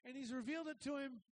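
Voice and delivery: ordinary talk, nothing marked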